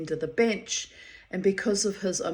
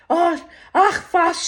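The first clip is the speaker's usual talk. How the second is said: mock scream; 9 semitones higher, 9 dB louder, slower than usual. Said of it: "she screams at me".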